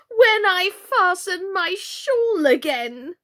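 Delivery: slow, high, moaning complaint; groaning. Acted. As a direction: groan it out, pitched well up. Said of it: "the poor Queen groaned out".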